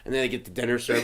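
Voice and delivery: usual speaking voice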